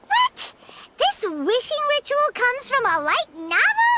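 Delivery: stunned cry of disbelief; surprised. Real, not acted. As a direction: jolt at the news. Shocked by light novel source.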